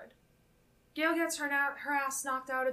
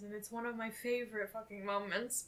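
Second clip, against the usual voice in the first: usual speaking voice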